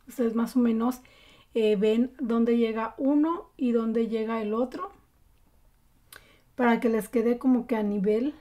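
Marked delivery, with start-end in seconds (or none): none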